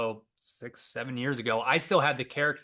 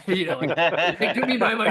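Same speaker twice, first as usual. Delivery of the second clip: laughing; 6 semitones higher, 7 dB louder than usual. Real, not acted. Laughing at his own story.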